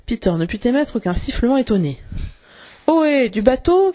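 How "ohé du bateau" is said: high, drawn-out call rising at the end; ringing hail. A narrator's reading. Crochet hailing his men across the lagoon, ringing call. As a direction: hail a boat far across the water. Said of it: "cria de nouveau la voix".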